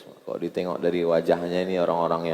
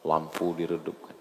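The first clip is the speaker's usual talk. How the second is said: ordinary talk, nothing marked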